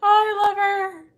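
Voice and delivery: high, loud gushing through laughter. Real, not acted. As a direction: gush like a thrilled fan. Fangirling over May.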